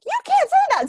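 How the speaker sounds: high, loud indignant shout; outraged high yell "You can't say that"; mother-in-law outraged. Retold or imitated. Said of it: "she's like".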